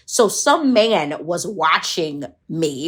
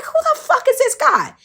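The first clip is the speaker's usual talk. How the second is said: much higher, baffled outrage; incredulous. Retold or imitated. Girl reacting to a stranger's scolding, high incredulous. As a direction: squeak out the disbelief.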